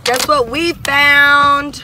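drawn out, loud teasing question; excited tease. Real, not acted. Teasing her viewers about the find, slow and loud. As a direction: stretch it slowly to build suspense.

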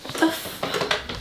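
hushed startled curse; breathless whisper of alarm. Real, not acted. Startled by a loud noise.

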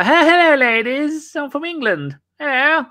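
mock flirty Englishman saying hello; hammed-up English charmer. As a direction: play the charming Englishman abroad, overdone. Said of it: "I go".

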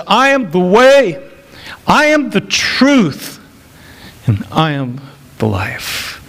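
a preacher proclaiming Jesus' words; emphatic proclamation. Acted. Preacher quoting Jesus, building.

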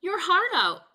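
mocking taunt. Pizza eater taunting Bullfrog, loud and gloating. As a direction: rub it in his face.

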